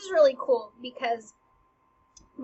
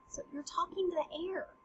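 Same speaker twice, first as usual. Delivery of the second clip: ordinary talk, nothing marked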